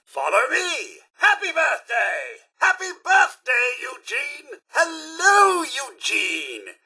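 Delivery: high, festive birthday announcement; high-pitched, drawn-out celebratory calling; party host cheer. Acted. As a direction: hail the birthday child with big showy cheer. Robot party host greets birthday child.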